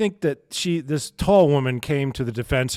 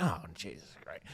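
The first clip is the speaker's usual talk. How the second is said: muttered dread; 9 semitones lower, 16 dB quieter than usual. Retold or imitated. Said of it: "And I'm like".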